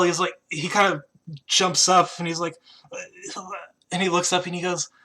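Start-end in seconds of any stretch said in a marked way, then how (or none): none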